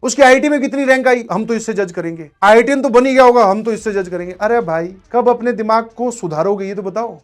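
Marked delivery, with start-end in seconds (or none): 0.0-4.3 lightly mimicked students, louder and higher; imitating judgmental students. Teacher mimics students judging a topper by his rank, insistent imitation. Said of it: "तुम्हारी mentality क्या है, तुम क्या सोचते हो".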